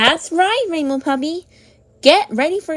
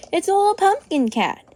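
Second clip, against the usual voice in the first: ordinary delivery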